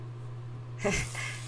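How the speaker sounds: a quiet airy chuckle; soft breathy laugh. Real, not acted. Friend laughing quietly at Micah's protest. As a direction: laugh softly at the joke.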